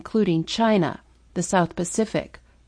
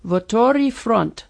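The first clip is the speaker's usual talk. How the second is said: put-on Russian-accented slogan, slowly; 3 semitones higher, slower than usual. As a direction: repeat it like a Russian mantra. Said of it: "could repeat the mantra".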